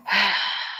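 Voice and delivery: long breathy sigh of dismay; sighing as the news sinks in; heavy sigh. Retold or imitated. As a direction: let out a long, heavy exhale. Reacting to deployment news.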